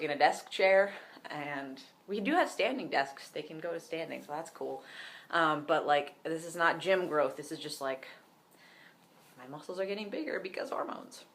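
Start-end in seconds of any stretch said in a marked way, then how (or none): none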